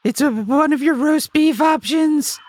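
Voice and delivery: high, fussy, increasingly impatient; exasperated high customer. Acted. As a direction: correct the clerk, exasperated. Customer insists in skit.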